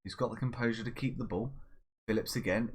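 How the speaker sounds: ordinary talk, nothing marked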